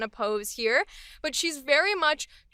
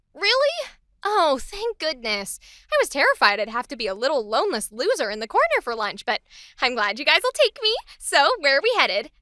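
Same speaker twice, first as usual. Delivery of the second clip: happy, genuinely excited. Acted. New worker invited to lunch by coworkers, happy and relieved. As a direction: gush with relief at being included. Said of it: "she is genuinely just excited".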